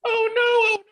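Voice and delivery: high mocking oh no that fades to a mutter; mock dismay. Cohost teasing the narcissist line with mock dismay. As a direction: tease him with a fake gasp that sinks away.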